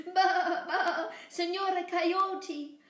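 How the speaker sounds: high, loud bleating lamb voice. Acted. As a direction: bleat it high, tempting him. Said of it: "Boragita bleated".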